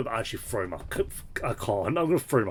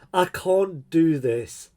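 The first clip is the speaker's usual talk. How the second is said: louder, slow and drawn out; exasperated outburst; 6 semitones higher, 5 dB louder, much slower than usual. Real, not acted. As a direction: let the frustration out slowly and loudly.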